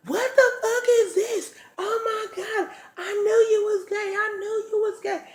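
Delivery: mimicked girlfriend's high-pitched screeching; outraged screech. Girlfriend walking in on them, mimicked outraged screech. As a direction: imitate her shrieking in outrage, voice way up.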